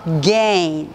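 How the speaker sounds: again said slowly as two smooth syllables; again linked, not split into Korean syllables; native again. Shown as a demonstration. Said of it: "보통 우리가 again 이렇게 발음하시지만".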